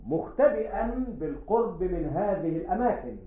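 high, slow stage declamation with long pauses; Comédie-Française declamation. Acted. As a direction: declaim it like a classical tragedian, drawn out. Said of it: "كما يتكلمون في الكوميدي فرانسيز".

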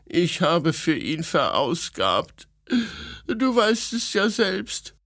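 high, wounded complaint; aggrieved princess. A narrator's reading. Princess complaining about her ungrateful nephew, high and wounded. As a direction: lament as a hurt princess.

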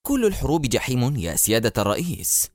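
usual speaking voice

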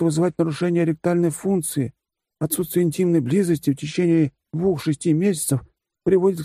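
dry, matter-of-fact medical recital; pedantic lecture. A narrator's reading. Furry teammate lectures his commander, lightly voiced know-it-all. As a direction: reel off the facts like a textbook.

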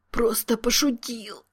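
squeezed, high whispered squeak; strangled whisper. A narrator's reading. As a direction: squeak it out in pain, barely voiced. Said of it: "то ли прошептал, то ли пропищал сдавленным голосом". (сдавленный писклявый шёпот)

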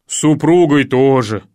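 loud, heavy-hearted sigh of agreement; вздыхая. Acted. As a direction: sigh it out, resigned. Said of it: "вздохнул Кукушкин". (громко, с тяжким вздохом)

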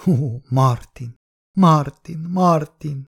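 high, drawn-out wail of a name; crying out. A narrator's reading. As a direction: wail the name in grief, pitch climbing. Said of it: "sírta".

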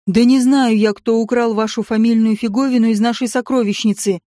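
ordinary delivery, nothing marked

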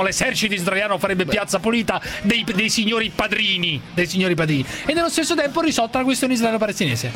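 ordinary talk, nothing marked

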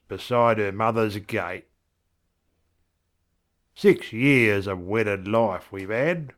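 put-on broad old-time Australian voice, lilting and unhurried; vernacular verse recital. Husband remembering his wife Doreen, broad Australian verse recital. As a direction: recite as a rough-spoken, fond husband.

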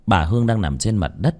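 ordinary delivery, nothing marked

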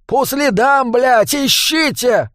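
high, drawn-out angry shout; exasperated yell, very high pitch; furious order. A narrator's reading. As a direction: shout the order at slow-witted henchmen. Dwarf yells order at henchmen.

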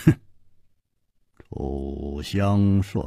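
low, very slow, unconcerned; cool indifference. A narrator's reading. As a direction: draw it out low and unimpressed. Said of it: "淡淡地说". (低沉缓慢，淡淡地说)

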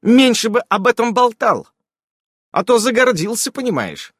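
sharp irritated rebuke; flaring up. A narrator's reading. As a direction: suddenly flare up at him. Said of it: "непонятно с чего взвелась Ирен".

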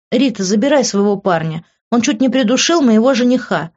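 seething demand; angry. A narrator's reading. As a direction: spit out the accusation. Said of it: "зло сказала она".

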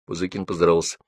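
ordinary delivery, nothing marked

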